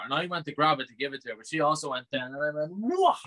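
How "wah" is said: a sudden high yelp; mock startled yelp. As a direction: yelp like you got a fright. Retold startled cry mid-story.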